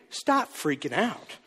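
slow, hushed calming-down voice. Acted. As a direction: draw it out quietly to pacify them. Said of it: "talk slow and at a lower volume".

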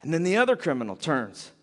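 ordinary talk, nothing marked

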